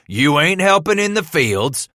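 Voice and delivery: loud, high, heated burst; angry interruption. A narrator's reading. Father interrupting son's excuse, angry. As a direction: cut him off sharply. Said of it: "Thaddeus interrupted".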